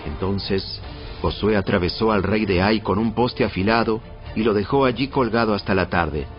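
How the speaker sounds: ordinary delivery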